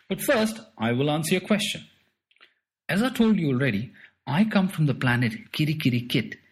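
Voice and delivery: a boastful little spaceman, a touch put-on; strange little alien man. Strange little man tells boy where he comes from, lightly voiced. Mister Trick Them All speaks.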